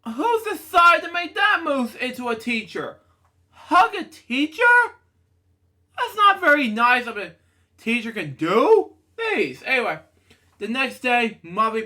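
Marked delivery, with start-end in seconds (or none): 0.0-9.6 a character's line read much higher. Acted. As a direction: play the upset character in a high voice. Reads a character's line aloud.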